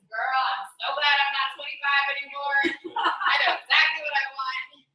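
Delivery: loud, laughing sass; loud laughing girlfriend talk; laughing reply. Retold or imitated. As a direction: tease her with a big laugh. Friend answering with a laugh.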